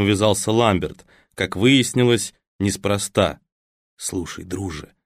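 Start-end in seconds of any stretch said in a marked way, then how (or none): 4.0-4.9 hushed whisper to a friend. A narrator's reading. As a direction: whisper it in his ear. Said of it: "сообщил он шёпотом". (шёпотом на ухо)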